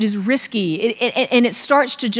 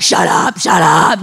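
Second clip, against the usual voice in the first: loud, hissed shut up; loud hushing; 4 semitones lower, 8 dB louder, slower than usual. Retold or imitated. Insiders hushing the curious crowd, loud hiss. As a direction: hush them hard and loud. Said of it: "they're like".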